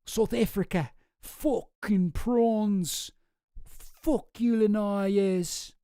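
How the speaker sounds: bad South African accent; a rough, mocking South African accent; hammed-up South African accent, far from real. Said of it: "I can't do a South African accent".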